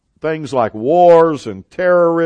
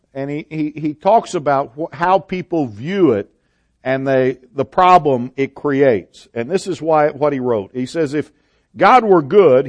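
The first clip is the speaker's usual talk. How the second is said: ordinary talk, nothing marked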